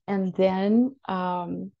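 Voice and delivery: normal talking voice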